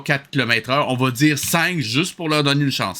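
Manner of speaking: ordinary delivery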